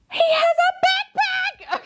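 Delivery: very high, slow squeal of delight; excited squeal. Real, not acted. Unboxer discovering the toy's backpack, high-pitched delighted squeal. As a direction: squeal it as if overcome by cuteness.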